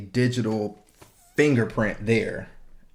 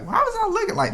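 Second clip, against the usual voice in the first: much higher mock baffled voice; future self; much faster than usual. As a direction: play your puzzled future self. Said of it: "you're gonna be like".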